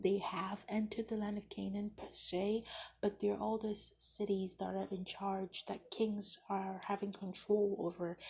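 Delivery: ordinary talk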